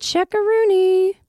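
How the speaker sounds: high, slow goofy correction; silly voice. Pretend. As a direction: fix the word in a high goofy voice.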